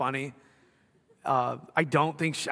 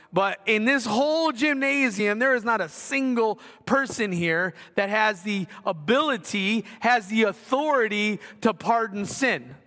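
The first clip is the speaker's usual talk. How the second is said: preaching; 4 semitones higher than usual. Acted. Preacher questioning congregation, voice raised.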